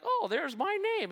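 sudden high, bright-eyed "oh" of discovery; mock surprise. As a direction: play a kid delighted to find his name. Teen finds sign in locker.

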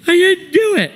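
very high mock-kid whine; high-pitched childish protest. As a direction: imitate a boy squealing his denial. Child threatened with spanking protests.

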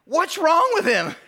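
high, slow alarmed question; mock panic over the baby; panicked new dad. New dad asking nurses about screaming son, mock panic.

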